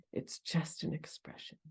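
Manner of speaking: breathy whispered repeat; soft whisper. Real, not acted. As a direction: let the words fall to a whisper. Repeats phrase softly, unvoiced.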